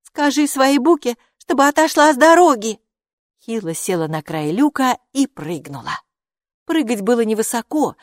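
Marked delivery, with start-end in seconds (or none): 0.0-2.8 high, loud demand; bossy, raised-voice command; snippy order. A narrator's reading. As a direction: order her off impatiently. Hilla orders, scornful.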